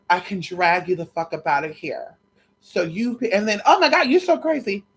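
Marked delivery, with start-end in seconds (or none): 3.6-4.8 mocking quote. Mocking the guy's reaction.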